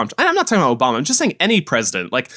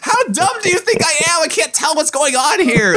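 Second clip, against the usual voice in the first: high-pitched incredulous rant; exasperated outburst; 11 semitones higher than usual. Retold or imitated. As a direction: blurt it out in hammed-up outrage, voice shooting up. Venting mock outburst at bank staff.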